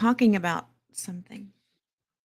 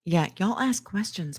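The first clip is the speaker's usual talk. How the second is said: usual speaking voice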